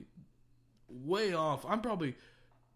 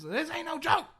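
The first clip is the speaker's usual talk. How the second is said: high, loud imitated comeback; mock angry retort; 13 semitones higher, 7 dB louder than usual. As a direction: snap back as the offended stranger. Acting the stranger's angry answer.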